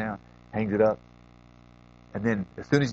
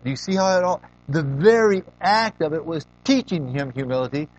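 rising, louder preaching build; 6 semitones higher, 4 dB louder than usual. Acted. Preacher urging the listeners to see the lesson. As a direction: drive the point home like a preacher.

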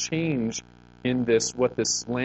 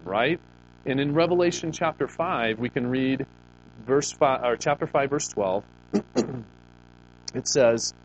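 usual speaking voice

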